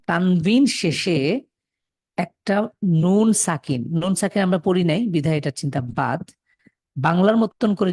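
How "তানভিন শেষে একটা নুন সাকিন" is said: slow emphasis. Shown as a demonstration. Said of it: "explaining tanvin ending".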